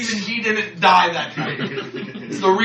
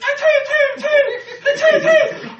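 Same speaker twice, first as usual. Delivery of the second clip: high, tiny achoo sneezes; dainty fake sneeze. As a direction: squeak out little sneezes. Imitating repeated tiny sneezes.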